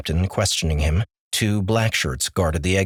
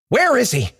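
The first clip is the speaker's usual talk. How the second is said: high, loud demand; 4 dB louder than usual. Acted. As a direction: demand it sharply. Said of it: "Austin demanded".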